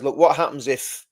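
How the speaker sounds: ordinary talk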